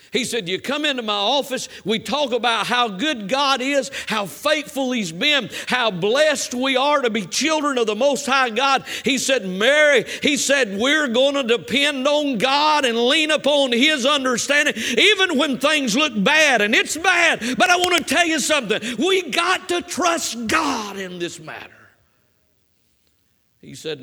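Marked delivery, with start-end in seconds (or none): 0.5-16.6 loud, high, building preacher's roar; loud authoritative exhortation, building; voice of authority. Retold or imitated. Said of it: "voice of authority". 16.6-21.9 loud, high shout that slows on the last line; shouted sermon climax; fiery preaching. Acted. Preaching at full force.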